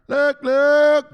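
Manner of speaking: high, loud repeated call; big showman's call; attention-grabbing shout. Pretend. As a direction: holler it to seize attention. Calls for attention in his bit.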